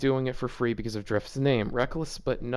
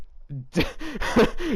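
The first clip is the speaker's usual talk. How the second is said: giggle; 9 semitones higher than usual. Real, not acted. Laughing at creepy giggling idea.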